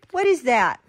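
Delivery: plain reading voice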